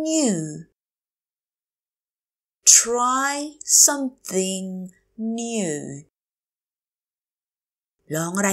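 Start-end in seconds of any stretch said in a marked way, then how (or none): 2.8-5.7 Try, something, new, drawn out with gaps; slow teacher model of "Try something new"; word by word. Shown as a demonstration. Teacher repeats phrase word by word.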